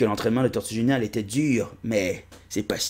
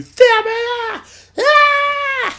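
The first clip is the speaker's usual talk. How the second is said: very high, loud, slow yell; angry cartoon shout; 7 dB louder, much slower than usual. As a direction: snap at him in a squeaky rage. Personnage tire, crie. (cri aigu et furieux)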